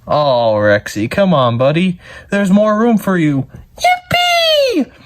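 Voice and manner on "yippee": high joyful shout; excited yippee. Acted. Rexy is invited to dance, joyful high yell. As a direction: squeal with delight.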